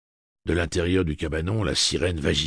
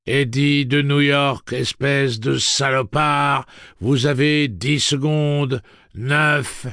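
loud, slow, raised monotone; high robotic countdown without expression; toneless robot voice; 9 semitones higher, 9 dB louder, much slower than usual. A narrator's reading. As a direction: drone it out like a machine. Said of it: "d'une voix sans timbre".

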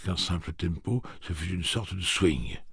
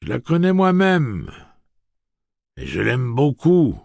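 high, loud, stately gunslinger speech; Roland's formal address; 9 semitones higher, 10 dB louder than usual. Acted. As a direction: announce it grandly to the whole crowd. Roland speaks.

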